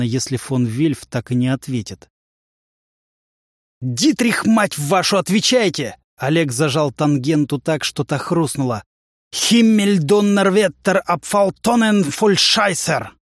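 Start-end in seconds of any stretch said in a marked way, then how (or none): 3.8-6.0 high, urgent yell into the radio; shouting into radio. Acted. Yells into the radio. 9.3-13.2 slow, high German swearing; German cursing. Acted. German curse into radio.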